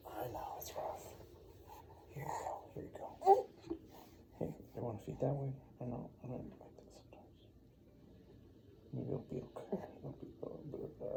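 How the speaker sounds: soft soothing whisper. Real, not acted. Hushing baby.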